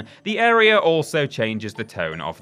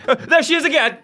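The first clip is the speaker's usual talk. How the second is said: startled cry; 5 dB louder, faster than usual. Pretend. Ghost girl suddenly reappears.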